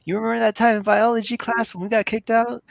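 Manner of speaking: excited goofy friend. Retold or imitated. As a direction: gloat in a high silly voice. Voicing excited Dale.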